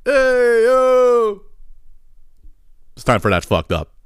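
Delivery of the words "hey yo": high, drawn-out holler; mock screaming at window. Man on the sidewalk calls up to the balcony, high mock holler. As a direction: imitate a guy hollering up to a balcony. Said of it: "Fucking screaming at the window".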